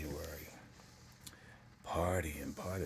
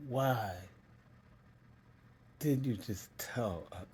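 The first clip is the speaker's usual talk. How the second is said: higher, halting, choked retelling of mom; long pauses, voice pitched up with emotion; emotional retelling; 4 semitones higher, slower than usual. Real, not acted. Mom asking child after coming out, emotional retelling. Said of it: "I'm sorry".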